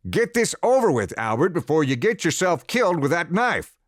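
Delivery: loud, high furious order; shouting father. Acted. Father shouting at his son to finish the pig, loud and furious. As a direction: bark it at the boy over the noise.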